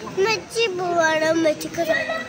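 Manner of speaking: loud, upset protest. Real, not acted. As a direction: call it out loudly over the parting. Child upset at mother leaving. (സങ്കടത്തോടെ ഉറക്കെ)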